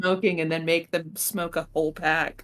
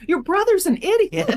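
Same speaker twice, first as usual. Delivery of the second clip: higher, louder joking jab; mock blunt punchline; 13 semitones higher, 5 dB louder than usual. Real, not acted. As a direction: blurt it out for laughs.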